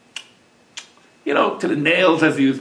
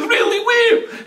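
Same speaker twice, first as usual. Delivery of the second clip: very high, thrilled questions; excited surprise; slower than usual. A narrator's reading. Wife hears he has an esrog, high excited surprise. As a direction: squeal the questions as the eager wife.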